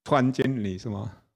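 normal talking voice

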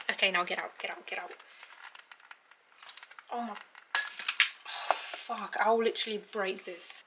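ordinary talk, nothing marked

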